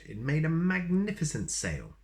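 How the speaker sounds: plain reading voice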